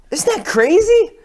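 loud, high, drawn-out exclamations; hyped-up amazement. Acted. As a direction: stretch it out, amazed at your own tale. Hypes his own tall tale.

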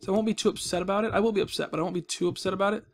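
ordinary talk, nothing marked

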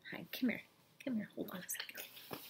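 hushed whisper to the cat. Real, not acted. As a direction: whisper softly, calling the cat. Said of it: "I'm whispering 'cause my roommate is sleeping".

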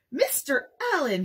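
put-on small boy drawing himself up in offended dignity; stiffly offended, pointing an accusing finger. Toddler Jack accusing the guest with offended dignity. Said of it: "he said in offended dignity".

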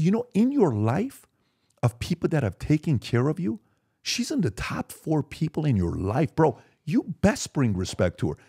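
high, heated scolding of a disrespectful son; a father raging at his son for disrespecting the nanny; retold furious scolding. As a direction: let the outrage climb as you tear into him. Father confronting son over nanny.

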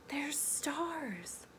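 soft unvoiced reveal; secret whisper. Revealing the star shapes to a child, whispered.

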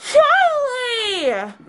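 high, delighted cry of a name; excited call. Real, not acted. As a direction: call out with joy. Greets dog excitedly.

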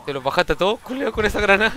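loud, high, amazed shouting; excited shout. Real, not acted. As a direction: yell it out in disbelief. Teammate's grenade wipes the enemy.

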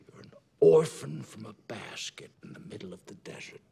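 loud harsh whisper. Acted. Father revealing the son's origin in a raging whisper. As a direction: hurl the secret at him in a whisper.

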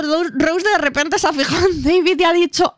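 usual speaking voice

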